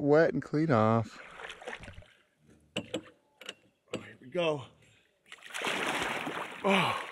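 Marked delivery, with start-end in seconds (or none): 2.8-7.1 sinking into water. Real, not acted. Easing into lake water.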